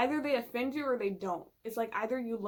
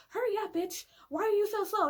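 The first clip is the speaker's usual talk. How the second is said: higher and louder, pushy impatient friend; 10 semitones higher, 6 dB louder than usual. Retold or imitated. Friend Caesar rushing her on a walk, impatient and loud. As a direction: hurry her along, annoyed.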